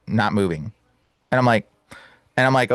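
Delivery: usual speaking voice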